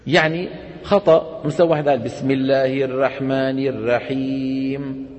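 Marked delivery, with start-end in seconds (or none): 2.0-5.1 الرحمن الرحيم sung slowly with a thin, light r; thin r recitation. Shown as a demonstration. Teacher demonstrating a subtle error, slow chanted recitation with a thin r. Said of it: "رقق مين؟ الراء".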